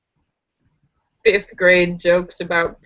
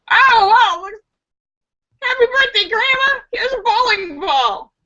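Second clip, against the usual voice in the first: much higher, louder mock-excited voice; fake cheerful grandchild; 14 semitones higher, 3 dB louder than usual. Grandchild gives grandma a bowling ball, high and chirpy. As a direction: overdo the cheer while handing over the gift.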